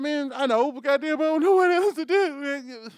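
mock-despairing reply to a fan; exasperated whine. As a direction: throw up your hands and whine it loud. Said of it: "And I'm like".

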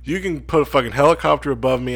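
mock bailing out. Imagining himself among biting ants, urgent mock plea. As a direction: shout for rescue like the ants are on you. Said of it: "I'd be like".

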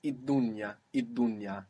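id dunya with the l assimilated to d; assimilated article. Shown as a demonstration. Teacher shows the article il becoming id. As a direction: say id dunya, not il dunya.

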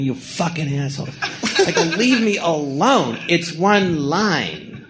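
exasperated, drawn-out words without real volume; mock yelling. Actor fed up with notes on his one line, mock yelling. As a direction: retell an outburst, stretching each word in frustration. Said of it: "I was, like, yelling at them".

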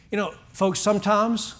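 ordinary talk, nothing marked

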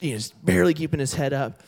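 usual speaking voice

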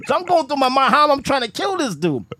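usual speaking voice

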